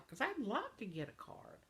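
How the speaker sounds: ordinary delivery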